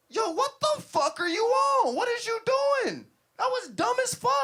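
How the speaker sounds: very high, loud, outraged shout; furious yelling. Retold or imitated. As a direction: yell at the driver in disbelief. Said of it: "I got out the car yelling".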